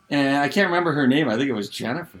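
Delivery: normal talking voice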